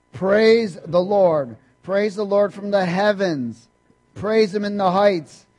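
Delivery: loud, high, measured praise chant; psalm read aloud together. Acted. Preacher leading the congregation reading the psalm aloud. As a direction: lead the room in proclaiming praise.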